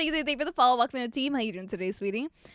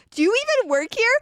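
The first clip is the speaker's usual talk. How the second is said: higher, louder mock sneer; mocking the customer; 9 semitones higher, 5 dB louder than usual. Barista mimicking the customer, mild mocking sneer. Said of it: "sitting here going".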